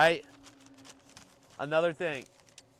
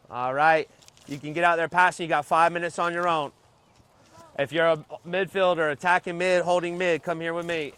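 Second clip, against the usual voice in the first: calling out; 8 dB louder than usual. Real, not acted. Coach calling players over.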